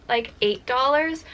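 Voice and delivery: usual speaking voice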